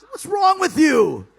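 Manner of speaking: loud, high, slow shout; incredulous shout. Retold or imitated. Yelling at Vince over the weak plan, loud and slow. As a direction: bellow it out in disbelief.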